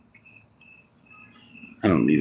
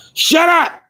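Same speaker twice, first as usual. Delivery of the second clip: very high shrill shut up; old lady shriek; 4 dB louder than usual. Acted. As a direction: screech like the old lady next door. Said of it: "Now I sound like the old lady next door".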